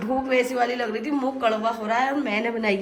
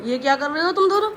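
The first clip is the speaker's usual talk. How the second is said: louder, quick telling-off; scolding; 4 semitones higher, 6 dB louder, faster than usual. Real, not acted. Mother to both children, scolding.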